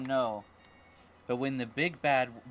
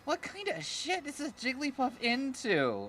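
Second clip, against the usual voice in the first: disgusted; 10 semitones higher than usual. Real, not acted. Disgusted reaction to Jigglypuff.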